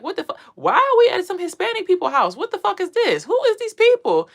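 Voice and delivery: freaking out at a stranger's house; shocked panic. Retold or imitated. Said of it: "I'm like".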